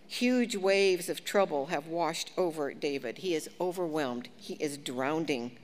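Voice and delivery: usual speaking voice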